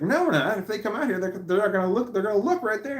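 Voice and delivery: high, insistent retold protest; heated argument. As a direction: push back hard but half in jest. Arguing over hiding candy bars.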